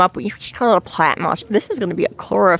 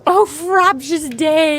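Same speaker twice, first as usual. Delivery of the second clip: mock recital; 8 semitones higher, slower than usual. Mock poem exclamation.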